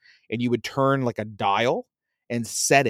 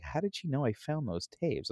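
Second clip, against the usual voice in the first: inner thought, hushed; 3 semitones higher, 11 dB quieter than usual. Retold or imitated. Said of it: "And I was like".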